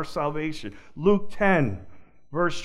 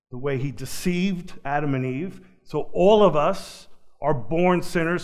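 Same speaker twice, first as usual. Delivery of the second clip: ordinary talk, nothing marked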